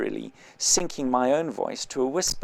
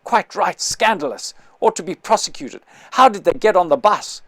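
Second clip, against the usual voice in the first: louder, indignant grumbling chorus; growled agreement; 6 semitones higher, 6 dB louder than usual. Acted. Passengers growling their indignant agreement. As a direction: back up the bully, disgruntled. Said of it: "growled the passengers".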